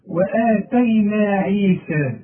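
وآتينا عيسى drawn slowly with a thin, light vowel; light recitation. Shown as a demonstration. Teacher showing the light vowel in recitation. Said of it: "برضه مرققة".

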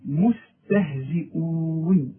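مستهزئون said slowly, all letters thin. Shown as a demonstration. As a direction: stretch مستهزئون with light vowels. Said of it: "مرققة". (مستهزئون مرققة وببطء)

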